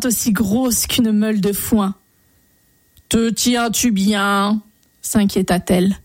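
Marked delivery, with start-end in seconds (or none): 3.1-4.6 slow, drawn-out creature voice; talking frog. A narrator's reading. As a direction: stretch the vowels like a croaking frog. Frog asks the hero riding it.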